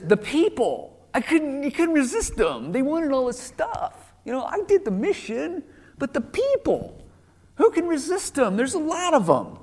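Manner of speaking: a king whining that the people made him do it; whiny excuse. Retold or imitated. Saul shifting blame.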